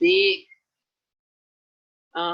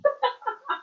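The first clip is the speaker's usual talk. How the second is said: laugh. Real, not acted. Laughing at own outburst.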